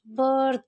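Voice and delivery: बर्थ with a drawn-out अ; stretched short a. Shown as a demonstration. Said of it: "अ को थोड़ा खींच के पढ़ेंगे".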